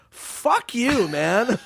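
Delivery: high, scornful fuck you; mock angry retort. Comedian snapping back at the critic who panned his movie, high mock anger. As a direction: fire it back like a wounded comic.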